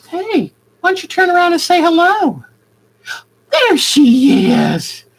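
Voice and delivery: high, sweet coaxing voice; pet talk. Real, not acted. Asking her bird to turn and say hello, high pet talk. Asks bird to greet viewers.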